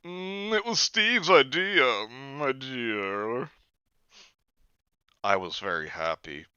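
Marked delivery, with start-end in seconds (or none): 0.0-3.5 higher, slow hummed Parker voice; Parker's voice. Retold or imitated. Parker answers the prime minister's thanks. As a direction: purr it as Parker, slowly. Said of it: "Mister Parker".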